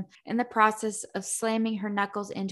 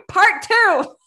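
high, loud announcement through laughter; laughing announcement; 11 semitones higher, 12 dB louder than usual. Real, not acted. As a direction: announce it while cracking up.

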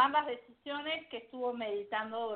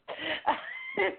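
short laugh. Real, not acted. Laughs about broken car.

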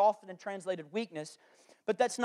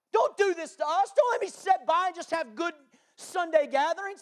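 impassioned prayer; 5 semitones higher than usual. Retold or imitated. Pastor begging God for the schools, prayer building to a cry. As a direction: cry out to God with mounting urgency. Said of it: "approach last night in my prayer".

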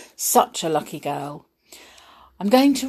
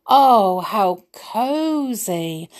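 loud gasp, then slow drawn-out delight; pleasantly surprised; 3 semitones higher, 5 dB louder, much slower than usual. Acted. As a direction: marvel at it with a gasp.

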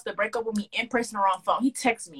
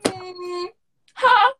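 shocked; 7 semitones higher, 4 dB louder than usual. Retold or imitated. He called her by the ex's name.

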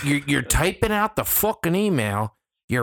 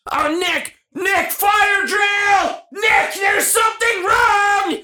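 much higher, loud mock panic; mock all-caps panic; 5 dB louder, slower than usual. Mocking a coworker's frantic all-caps email, shouted. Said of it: "In all caps".

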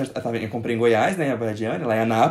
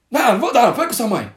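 mock stern father; 9 semitones higher, 6 dB louder than usual. Father refusing money.

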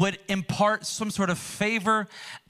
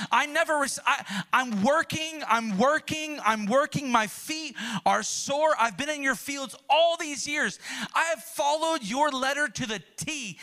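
pitched-up, building complaint that climbs higher to the end; bitter older brother venting to his father, voice climbing higher; resentful older son; 4 semitones higher than usual. Acted. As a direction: plead your grievance like a son who feels unseen, rising as it boils over.

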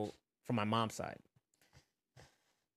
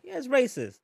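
retold blunt reply; 11 semitones higher, 8 dB louder than usual. His own retold reply at the show.

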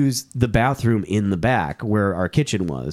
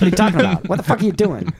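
mock exasperated boss; 7 semitones higher, 3 dB louder, faster than usual. Boss angrily confronts Phil in retelling.

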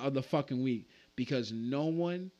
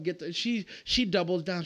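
ordinary delivery

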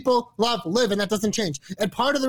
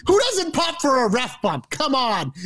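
higher-pitched mock outburst; imitating a raging wrestler; 5 semitones higher than usual. Imitating Rousey turning on the ref after the match, mock-indignant. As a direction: act out her indignant challenge to the ref. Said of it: "in a fit of rage, she attacks".

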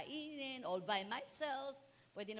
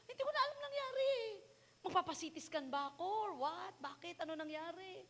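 high excited retelling; 13 semitones higher, 4 dB louder than usual. Retold or imitated.

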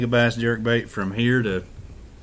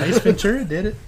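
laughing; 10 semitones higher, 4 dB louder than usual. Real, not acted. Teasing, laughing.

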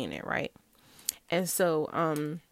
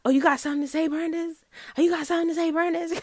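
higher, sassy mock taunt; sassy dare to the wife; taunting challenge; 8 semitones higher, faster than usual. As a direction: imitate a provoking rival. Side chick taunting the narrator.

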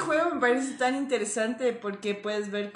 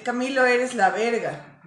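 insulting tone. Shown as a demonstration. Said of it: "O sea, es malo".

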